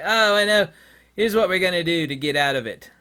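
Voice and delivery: high, loud hammed-up plotter voice; smug planner with a bright idea; mock schemer. Bankers plotting their way out, hammed-up scheming voice. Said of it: "It's like".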